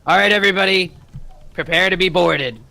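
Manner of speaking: rally the whole crew with one order; captain's command. Acted.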